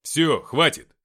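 loud, firm order; commanding. A narrator's reading. Narrator ending the fuss with Nikita, commanding. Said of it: "приказал я".

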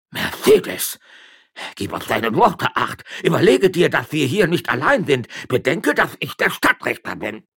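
high, loud indignant warning; stern warning. Acted. Magistrate warning his wife before witnesses, angry. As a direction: pull rank on her.

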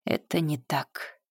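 soft, slow admission; quiet and slow. Acted. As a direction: say it low and slow. Admits fear quietly.

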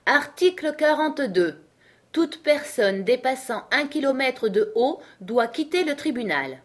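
high, projected official proclamation; reading aloud. A narrator's reading. King reading a rule aloud to the court, proclaiming. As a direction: proclaim the rule to the whole room. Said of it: "se mit à lire à haute voix".

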